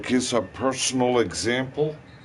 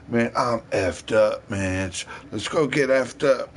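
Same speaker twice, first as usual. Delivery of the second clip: ordinary delivery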